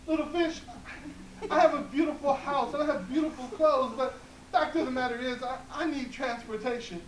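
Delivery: high, loud pleading with a laugh; high wheedling old woman. Acted. Old woman asks the fish.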